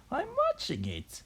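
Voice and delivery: put-on very high old-woman screech; shouting back. A narrator's reading. Old woman answering George, high mock screech. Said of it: "the old woman shouted back".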